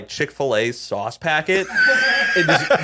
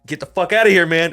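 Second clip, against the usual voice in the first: retold shout; 6 semitones higher, 6 dB louder, faster than usual. Coworker slams door on arm.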